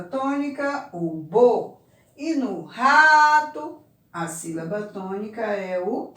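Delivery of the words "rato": "rato" high and loud, the RA stretched long; stressed syllable drawn out. Shown as a demonstration. Teacher showing where the stress falls, first syllable loud and long.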